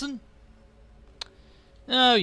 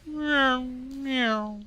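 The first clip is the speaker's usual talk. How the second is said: cat meow; 6 semitones higher than usual. Pretend. Imitating a cat.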